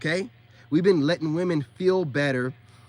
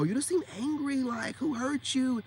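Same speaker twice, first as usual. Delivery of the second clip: high, soft mock concern; mock woman correcting; 7 semitones higher, 4 dB quieter than usual. Mimicking women trying to correct a man, mock concern. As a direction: lightly mimic a woman's higher voice. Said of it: "that's what you'll hear from women".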